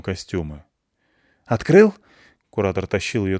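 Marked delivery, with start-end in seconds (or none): 1.5-1.9 shouted question. Acted. Curator calling to Andrey.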